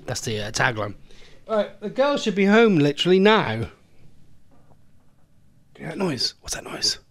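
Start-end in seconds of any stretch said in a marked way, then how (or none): none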